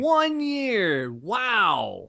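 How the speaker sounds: high, drawn-out exclamation of amazement; amazed cheer. Real, not acted. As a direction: whoop it out long in celebration. Cheering the podcast's anniversary.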